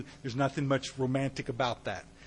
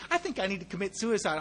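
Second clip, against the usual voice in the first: mocking the casual version; 4 semitones higher, 4 dB quieter than usual. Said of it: "I mean, a bona fide".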